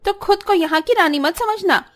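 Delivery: high-pitched haughty scolding; scornful sister-in-law. Acted. Sister-in-law puts bride in her place, scornful.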